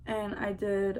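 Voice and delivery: ordinary talk, nothing marked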